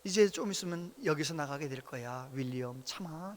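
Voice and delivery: calm voice. Retold or imitated. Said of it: "차분한 소리로 그러는 거예요".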